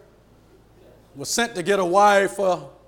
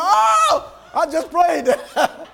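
high, loud, drawn-out cry of amazement; shocked servant; 10 semitones higher, 7 dB louder, much slower than usual. Retold or imitated. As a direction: act out the servant's astonished outburst. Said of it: "shocked him".

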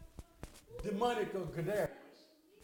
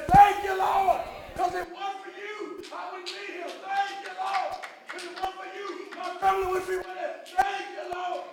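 loud, high cry of thanks; shouting praise; 10 semitones higher, 8 dB louder than usual. Pretend. Believer fallen down in heaven thanking the Lord, shouting. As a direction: cry it out in ecstatic gratitude.

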